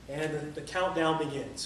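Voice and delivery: usual speaking voice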